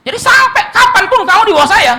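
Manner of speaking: high, loud lordly declaration; domineering shout. Acted. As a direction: bellow your power over him. Patron voiced claiming lifelong power. (teriakan patron yang berkuasa)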